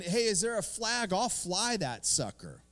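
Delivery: high, eager mock churchgoer, slowing at the end. Pastor mocking a worshipper who wants to wave a flag, hammed-up eager voice. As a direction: ham up a zealous worshipper asking for a flag.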